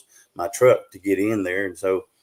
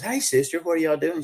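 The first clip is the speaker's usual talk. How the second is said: sister's greeting; 8 semitones higher than usual. Retold or imitated. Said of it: "she goes".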